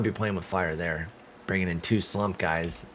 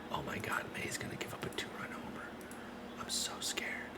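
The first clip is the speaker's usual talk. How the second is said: hushed, soft, voiceless dread; scared whisper; 6 semitones lower, 15 dB quieter than usual. Real, not acted. Nervous gamer dreading his pitcher's next throw, scared whisper. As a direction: whisper it like you're afraid to jinx it.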